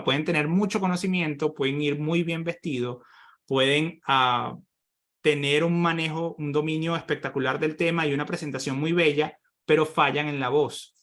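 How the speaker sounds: ordinary delivery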